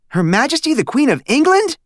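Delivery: put-on very high amazed boy on England; boy stunned by a call from the Queen; astonished blurt. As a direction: blurt it out in disbelief. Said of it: "Stanley blurted out".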